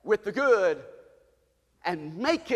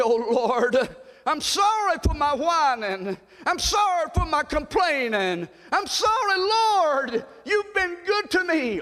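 repentant cry; 7 semitones higher, slower than usual. Retold or imitated. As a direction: plead for forgiveness at full voice. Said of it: "and say".

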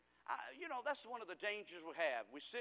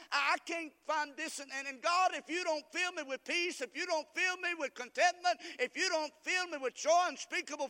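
desperate prayer; 9 semitones higher, 9 dB louder than usual. Acted. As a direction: cry out to God in frustration.

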